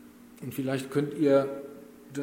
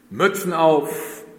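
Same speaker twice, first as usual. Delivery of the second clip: loud, slow, higher order; barked command; 4 semitones higher, 8 dB louder, slower than usual. A narrator's reading. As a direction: roar the command slowly. Camp chief's command again.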